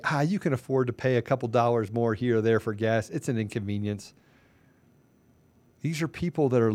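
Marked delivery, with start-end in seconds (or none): 0.1-4.1 dismissive, much softer and lower; brushing off the cost, mocking the officials. Mocking officials on TV, soft and dismissive. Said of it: "they get on T V and they say".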